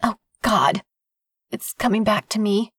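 usual speaking voice

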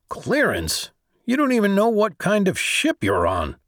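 high-pitched, incredulous comeback; mock the very idea, voice lifted; incredulous retort. A narrator's reading. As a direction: throw the challenge back in disbelief, pitch climbing high. Scornful retort to a challenge.

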